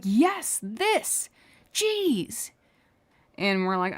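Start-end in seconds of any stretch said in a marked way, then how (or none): 0.0-2.5 much higher, impatient mock snap; exasperated customer. Imitating a rude customer snapping at coworker, exasperated. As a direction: play the rude guy losing patience.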